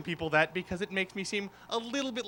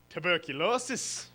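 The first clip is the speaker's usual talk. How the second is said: "tuberculosis" in a slow Irish lilt; Irish accent; 3 semitones lower than usual. Shown as a demonstration. Comic revealing the trigger word, slow Irish accent. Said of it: "the trigger word for Irish accents".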